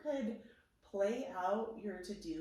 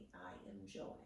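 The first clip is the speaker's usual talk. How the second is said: soft whispered affirmation; 4 semitones lower, 10 dB quieter than usual. Shown as a demonstration. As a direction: breathe it out in a whisper. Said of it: "you can say".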